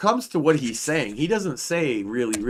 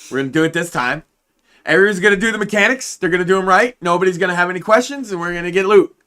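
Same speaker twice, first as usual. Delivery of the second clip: loud, high raid-leader hype speech; frat bro; 8 semitones higher, 8 dB louder, faster than usual. Retold or imitated. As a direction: pump the boys up like a raid leader. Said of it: "he goes into, like, these fucking".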